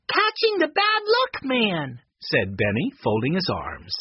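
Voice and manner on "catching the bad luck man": put-on very high, slow child's voice; accusing young boy. A narrator's reading. Benny answers Eddie with folded arms, accusing. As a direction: draw it out high, proudly accusing.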